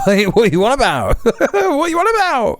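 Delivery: "what are you on about" spoken laughing and high; laughing disbelief. Real, not acted. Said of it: "laughing at the clip".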